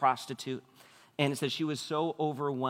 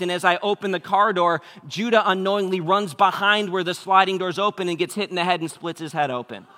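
higher and louder, racing through the mishap; rising panic retold; 5 semitones higher, 9 dB louder than usual. Dad retelling the parking-lot accident to the church, loud and rushed. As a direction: build the chaos as it unfolds.

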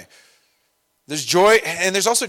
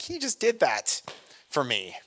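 awed realization; 3 dB quieter than usual. Retold or imitated. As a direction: marvel at it, then hush it. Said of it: "I'm like".

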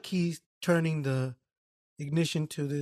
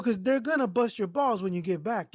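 ordinary delivery

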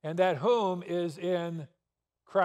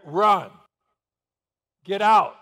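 loud urgent command to flee; urgent shout; 3 semitones higher, 11 dB louder than usual. Pretend. Preacher acts out warning the church to flee a gunman, loud urgent shout. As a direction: bark it like an alarm. Gunman enters, urging escape.

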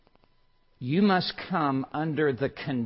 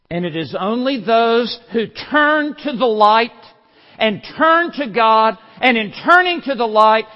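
higher and louder, driving the point home; preaching at full force; 8 semitones higher, 11 dB louder than usual. Acted. Preacher warning the congregation, rising preaching at full force. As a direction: thunder it from the pulpit.

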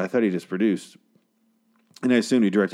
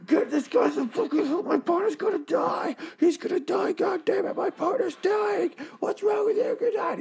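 high, strained hushed scream; mock screaming; 3 dB quieter than usual. Shane yells to the other cops for help, hushed mock scream. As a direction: shriek it at half volume so nobody wakes. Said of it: "He's just screaming to the other guys".

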